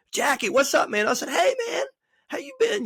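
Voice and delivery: put-on high-pitched, hailing an old acquaintance; acted hearty greeting. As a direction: imitate calling out hello from across a fence.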